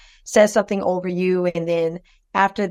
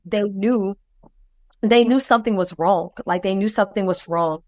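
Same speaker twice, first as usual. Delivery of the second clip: ordinary talk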